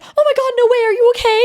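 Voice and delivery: very high, loud, frantic; mock panicked operator. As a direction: play an operator losing all composure. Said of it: "I'd be like".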